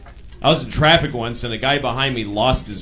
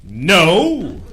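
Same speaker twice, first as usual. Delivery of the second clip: loud, emphatic superhero voice; superhero refusal; 3 semitones higher, 6 dB louder than usual. Acted. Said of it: "my impression of a superhero".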